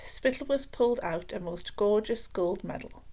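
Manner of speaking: plain reading voice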